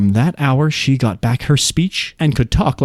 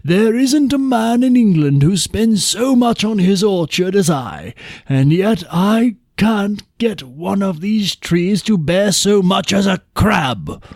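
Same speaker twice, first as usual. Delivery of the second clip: higher, slow complaint of the king; a king baffled by his barren orchard; puzzled king; 6 semitones higher, slower than usual. A narrator's reading. King to True while walking in the orchard, higher and slow. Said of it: "the king said".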